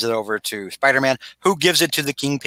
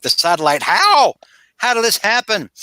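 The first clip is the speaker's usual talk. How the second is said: high, louder cry of disbelief on "how"; mock dismay; 8 semitones higher, 4 dB louder than usual. Retelling a villain whose satellite has exploded, mock dismay. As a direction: exclaim it in acted shock, pitch leaping. Acts Ra's shocked at explosion.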